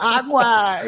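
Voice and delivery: high, far-off mock cry of agua; shrill imitation of a panicked neighbour; yelling in Spanish. Honduran neighbour calling the landlord about water, mock yell. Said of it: "yelling at me in Spanish".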